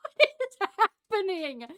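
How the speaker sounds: high, loud laugh through the words; laughing disbelief. Real, not acted. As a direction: laugh it out, overwhelmed.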